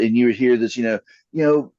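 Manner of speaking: ordinary delivery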